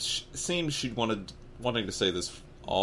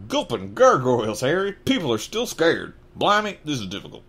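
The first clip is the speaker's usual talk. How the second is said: put-on southern accent; 7 semitones higher, 7 dB louder than usual. Flustered giant dreading the name, in a southern accent. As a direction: exclaim in a hammed-up southern accent. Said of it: "blimey in a dumbass southern accent".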